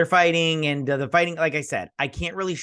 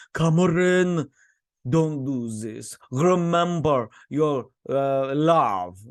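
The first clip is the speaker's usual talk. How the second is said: higher, slow, hammed-up plea; mock pleading grandpa; 3 semitones higher, 4 dB louder, slower than usual. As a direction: beg like a dying old man in a soap. Grandpa pleading with heart attack.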